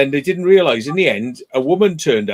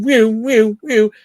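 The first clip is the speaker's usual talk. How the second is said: higher, louder whistled whews; mock wolf whistles; 8 semitones higher, 4 dB louder, much slower than usual. Drivers whistling at roadside women, mock whistles. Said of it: "Cars going".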